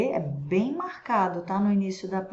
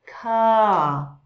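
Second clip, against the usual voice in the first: "Ca" with the pitch sliding down, louder; British "Ca" with a falling tune; 6 dB louder than usual. Shown as a demonstration. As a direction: let the pitch fall across "Ca". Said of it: "Note que tem uma descida".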